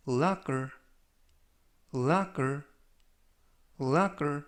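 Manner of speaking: locker said clearly three times, falling; model pronunciation. Shown as a demonstration. Teacher modelling locker for the class, careful and clear. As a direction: spell out locker slowly for learners. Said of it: "이라고 발음하시면 되겠고요".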